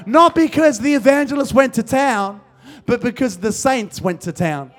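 loud, high, rousing preacher delivery; full-force preaching. Real, not acted. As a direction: build the crowd with emphatic, ringing declarations.

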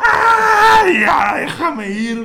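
high, loud, slow pleading yell with a laugh; hammed-up cry of let me go; laughing mock shout. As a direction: beg to be let go, half laughing. Pleading shout to leave, retold.

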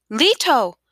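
high, loud call with a falling tail; anguished cry. A narrator's reading. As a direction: cry out after someone leaping away. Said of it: "Isabel cried".

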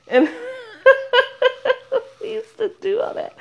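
high, squeezed laughing words; talking through laughter. Real, not acted. Podcaster recalling dances from her youth, laughing. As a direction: barely get it out while cracking up.

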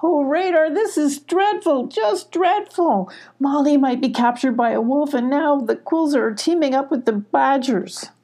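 high, tearful lament, slowing on dreadful; sobbing. Acted. Abby hearing Radar's news, sobbing lament. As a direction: wail the bad news through tears. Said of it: "Abby sobbed even louder".

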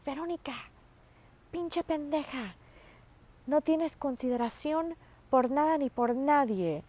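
high-pitched imitation of a scolding mother; scolding mom impression. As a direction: mimic mom scolding in Spanish. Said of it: "she'll be like".

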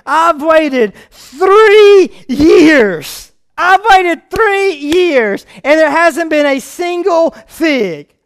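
slow, loud, drawn-out preaching; preaching the parable. Acted. Preacher reading Luke to the church, impassioned. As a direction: lean hard on the owner's impatience.

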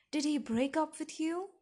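high, loud baffled question; perplexed. A narrator's reading. Perplexed father calling through his daughter's door, baffled. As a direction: ask it loudly in disbelief. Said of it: "Charlie was perplexed".

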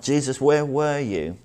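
pained blame. Retold or imitated. Sister confronts Jesus for coming too late, pained and blaming. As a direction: imitate a grieving sister's accusing plea. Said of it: "Up bubbles her pain and blame".

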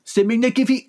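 put-on C'est magnifique in a French accent, pitched high; a French chef praising food. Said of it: "I can't do it now".

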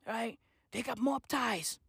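soft, low murmur; hushed aside. Pretend. Claiming politicians have mob ties, hushed murmur. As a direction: keep it down like a secret.

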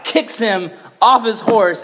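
normal talking voice

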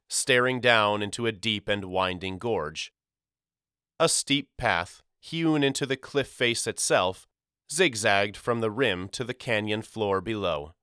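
ordinary delivery, nothing marked

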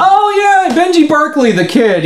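usual speaking voice

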